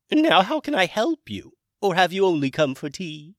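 high, loud dragon character voice; Hamish dragon voice. Acted. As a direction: play a chirpy, high-pitched dragon wizard. Hamish speaks.

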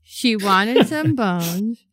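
slow, deliberate limerick; slow dramatic limerick; poem recital. Acted. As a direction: recite slowly, every word weighed. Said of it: "She goes".

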